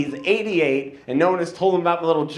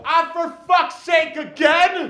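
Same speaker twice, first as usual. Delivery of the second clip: higher, fed-up groan of an uncle; exasperated uncle; 12 semitones higher, slower than usual. Retold or imitated. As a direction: sigh it out like a tired uncle. Uncle groaning at his announcement.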